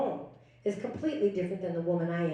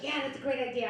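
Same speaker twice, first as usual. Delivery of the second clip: mock agreement; 6 semitones higher, 3 dB quieter, faster than usual. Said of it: "the guys in the room was like".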